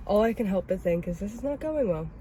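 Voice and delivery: usual speaking voice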